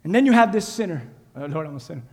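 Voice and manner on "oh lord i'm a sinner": much lower and softer, humble; a hushed, broken prayer. Acted. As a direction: barely breathe the confession.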